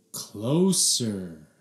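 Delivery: ordinary delivery, nothing marked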